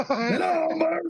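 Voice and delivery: high cartoon voice. Continuing silly character voices.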